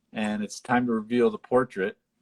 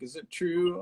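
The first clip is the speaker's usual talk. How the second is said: tearful question; 9 semitones higher than usual. Retold or imitated. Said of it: "and it's like".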